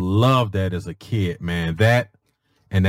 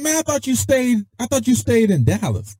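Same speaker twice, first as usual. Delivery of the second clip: high, incredulous retold protest; surprised disbelief; 12 semitones higher than usual. A sergeant tells his soldier he thought he lived in Dallas, pitched high and incredulous. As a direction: sound thrown, pitched way up. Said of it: "I said".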